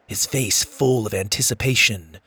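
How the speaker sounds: plain reading voice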